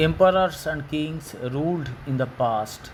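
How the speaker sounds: stretched, careful reading; slow reading. Shown as a demonstration. Teacher reading a textbook sentence slowly to pupils. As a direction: read it slowly for the class.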